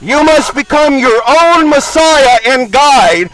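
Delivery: ordinary delivery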